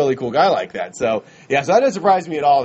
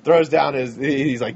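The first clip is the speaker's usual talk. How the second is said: usual speaking voice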